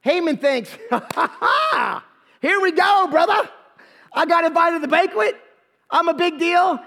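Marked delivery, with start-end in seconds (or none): 0.9-2.0 slow, higher mock-gloating "ha, ha, ha"; gloating laugh. Haman thinks the honor is his, gloating laugh. Villain's gloat imagined in retelling. 2.4-6.8 higher-pitched, hammed-up boasting; cocky gloating. Haman expects to be honored by the king, cocky gloating.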